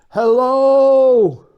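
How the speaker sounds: loud high call across the room; calling from far away. Real, not acted. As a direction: shout it across a big room. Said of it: "we'll shout to Dawn and see how far away".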